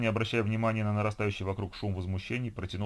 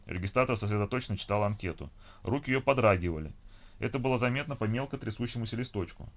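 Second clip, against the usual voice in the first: plain reading voice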